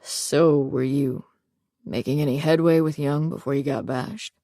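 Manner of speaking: low, rough male voice; deep gravelly voice. A narrator's reading. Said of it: "he asked in his deep and gravelly voice".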